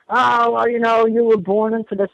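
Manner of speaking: high, dismissive mock of believers; mock voice of a pro-gray believer. Mocking pro-gray people's argument.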